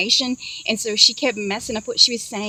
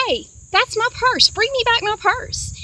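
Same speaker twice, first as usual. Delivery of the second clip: high, slow, raised-voice demand; calling out; 11 semitones higher, 4 dB louder than usual. Retold or imitated. Dreamer shouting after purse thief, calling out. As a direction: call after someone, pitched way up and drawn out.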